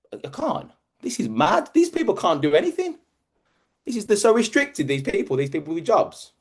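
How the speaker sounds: high, aghast disbelief; incredulous. Retold or imitated. Speaker recalling people restricted by jobs, high and incredulous. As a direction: pitch way up, marvelling at the madness.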